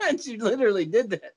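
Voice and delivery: usual speaking voice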